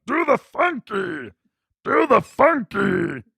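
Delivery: high, slow, garbled half-tongue voice; Uncle Rick. Retold or imitated. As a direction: holler it as if half your tongue is gone. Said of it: "she was kinda talking like Uncle Rick".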